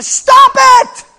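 high, loud cutting-off yell; sharp shout. Acted. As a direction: shout down the objection. Cuts off imagined objector.

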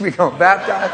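chuckling setup to the joke; laughing. Real, not acted. Pastor joking about baptizing in the pond, laughing.

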